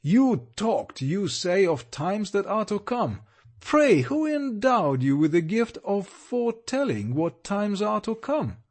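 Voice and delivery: mock her words with wrath; wrathful guardian. A narrator's reading. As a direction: guardian scorning his ward's talk, wrathful. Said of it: "glance of extreme wrath".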